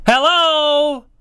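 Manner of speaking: loud, high, incredulous hello; exasperated. Real, not acted. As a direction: call it out as if nobody is home. Exasperated aside at missed obvious point.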